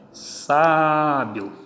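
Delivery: "Sábio" with a long, held SÁ; stressed syllable drawn out. Shown as a demonstration. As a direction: stretch the first A of "Sábio" to show the stress. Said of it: "Tonicidade aqui".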